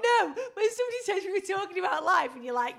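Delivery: loud, high, amused protest; high-pitched comic telling-off; exasperated teasing. Real, not acted. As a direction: cut in loudly to tease him. Teasing partner who forgot the conversation.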